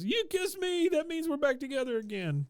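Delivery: put-on very high, squealed boy voice; mock desperate hope. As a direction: leap up high, clinging to hope. Retold dumped boy clutching at hope.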